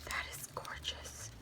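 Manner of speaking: hushed admiring whisper. Real, not acted. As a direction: breathe it out in admiration.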